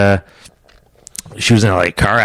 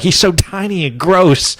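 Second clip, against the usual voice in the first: high, drawn-out mock disgust; disgusted girl; 8 semitones higher, slower than usual. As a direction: imitate a grossed-out girl, slowly. Girls' disgust, retold.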